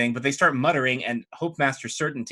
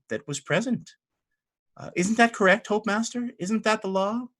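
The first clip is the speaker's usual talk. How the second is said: higher-pitched, sweetly lawyerly abbess; politely challenging abbess; 4 semitones higher than usual. Acted. Abbess questions Hope Master on the law, politely challenging. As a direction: question him politely, lifting higher at the end. Game master plays Radiant Mother.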